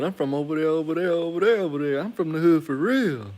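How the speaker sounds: high, loud mock tough woman; imitating loud woman.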